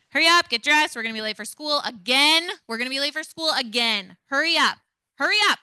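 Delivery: loud, high, rushed yelling climbing at the end; frantic parent yell. Shown as a demonstration. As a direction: yell like you're late for school. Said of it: "I'm going to yell".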